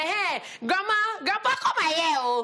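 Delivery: high-pitched imitation of a tattling niece; excited mock child telling on someone. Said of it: "she's like".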